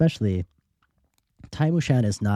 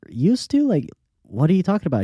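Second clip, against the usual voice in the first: incredulous surprise; 8 semitones higher, 3 dB louder than usual. Retold or imitated. Incredulous at man's past tense.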